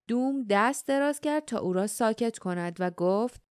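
usual speaking voice

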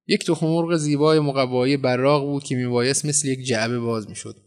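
ordinary delivery

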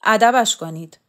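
loud, forceful command; barked order. A narrator's reading. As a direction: order the men to punish him. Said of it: "به آتشکارها دستور داد".

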